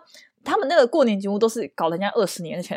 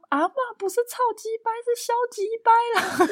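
high mock correction; 14 semitones higher, 4 dB quieter than usual. Sister correcting grandma.

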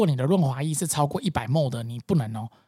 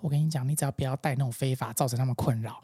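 低声无奈，像看透了一切; 懒懒地劝人别找麻烦; 厌世语气; 4 semitones lower, 7 dB quieter than usual. Retold or imitated. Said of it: "他非常有厌世". (厌世的海关口吻)

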